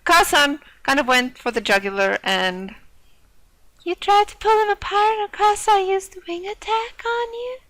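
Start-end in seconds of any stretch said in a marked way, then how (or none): 3.8-7.6 put-on high, bright-pitched girly character voice; girl voice. Classmate defending Okasan's pudding in a high girl voice. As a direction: play the classmate in a high girl's voice. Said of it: "I'm using my girl voice for him".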